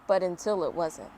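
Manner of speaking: ordinary delivery, nothing marked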